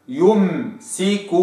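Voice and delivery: يمسك slowly, u then full m; held m. Shown as a demonstration. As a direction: stretch يمسك keeping the damma. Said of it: "هكذا".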